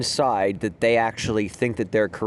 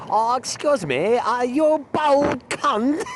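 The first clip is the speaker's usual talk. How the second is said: imitating a Chinese student's broken-English accent, high and slow; mock Chinese accent; 12 semitones higher, slower than usual. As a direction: put on a hammed-up Chinese accent, pitched well up and drawn out. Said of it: "they got the accent going on".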